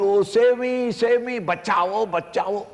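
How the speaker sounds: high, loud cry of save me; desperate high call mixing English and Hindi; crying out for help. Acted. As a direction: shout for rescue as if drowning. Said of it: "You are crying out".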